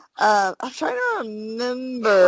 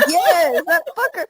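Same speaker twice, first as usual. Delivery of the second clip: high, gleeful laughing agreement; amused mock outrage, pitched up; laughing confirmation; 7 semitones higher than usual. Real, not acted. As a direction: confirm it while cracking up.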